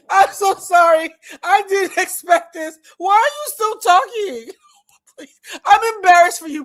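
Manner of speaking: slow, loud teary laughing plea; laughing apology. Real, not acted. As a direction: gasp it out through tears of laughter. Apologizing while laughing hard.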